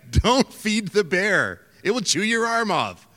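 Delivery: high, loud, slow mock warning; scolding a tourist feeding bears; local warning.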